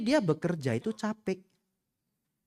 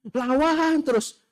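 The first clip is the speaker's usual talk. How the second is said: mock egging on; 12 semitones higher, 6 dB louder, slower than usual. Egging on a fight.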